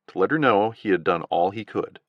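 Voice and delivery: usual speaking voice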